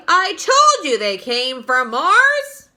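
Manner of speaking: slow, loud told-you-so; triumphant shout. A narrator's reading. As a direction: crow about being right. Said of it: "cried the chief of police".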